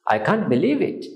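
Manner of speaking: ordinary talk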